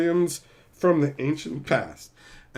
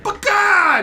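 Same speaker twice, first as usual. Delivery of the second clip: much higher, louder shrieked insult; mock shriek; 10 dB louder than usual. Angry woman in the bar, shrieking at him. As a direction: scream it as she charges. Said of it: "she's all like".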